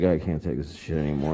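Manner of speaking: very low, drained grumble; exhausted parent. Acted. Parent collapsing in McDonald's with the kid, exhausted. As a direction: give up, voice sunk way down. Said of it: "and just, like, collapsed".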